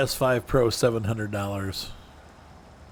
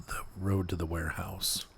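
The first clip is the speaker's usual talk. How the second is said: soft unvoiced whisper to himself; 3 semitones lower, 9 dB quieter than usual. Real, not acted. Gamer whispering directions to himself, whispered. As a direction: whisper the route under his breath. Reading route aloud to himself.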